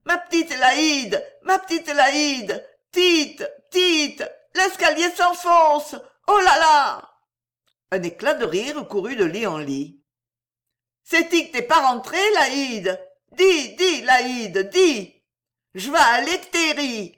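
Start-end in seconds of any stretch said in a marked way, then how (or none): 0.0-7.1 high, slow wailing plea; maudlin, high drawn-out lament; tearful calls. A narrator's reading. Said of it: "s'accompagner de larmes". 11.0-17.1 high, loud nagging appeal; insistent high wail; pleading call. A narrator's reading. Drunk woman keeps calling up stairs.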